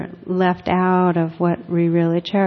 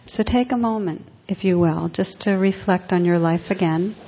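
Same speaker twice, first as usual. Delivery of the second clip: guided reflection. Acted. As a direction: ease listeners inward, letting lines fade. Leads a quiet reflection.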